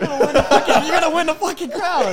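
half-shouting through laughter; laughing. Real, not acted. Laughing protest at friend's pick.

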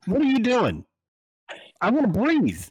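fed-up rant at Canadians; exasperated. Real, not acted. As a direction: throw the question up in disbelief.

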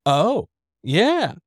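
"Oh, yeah." with a pause after "Oh"; high "Oh", then a separate, falling "yeah"; split in two. Shown as a demonstration. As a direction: break "Oh, yeah." into two slow, separate words. Versus takes of one phrase.

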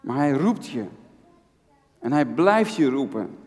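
ordinary delivery

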